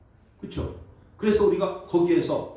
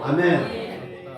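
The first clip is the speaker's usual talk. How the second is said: ordinary talk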